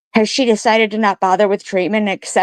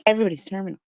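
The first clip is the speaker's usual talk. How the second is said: quieter repeat; 3 semitones higher, 6 dB quieter, much faster than usual. Real, not acted. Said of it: "repeats her own line".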